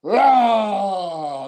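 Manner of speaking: MC intro building to a loud, high name; mimicking an announcer who blanks mid-introduction; imitated ring announcer. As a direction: announce big, then get stuck in a long stretched cry. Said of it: "he forgot Paul Smith's name".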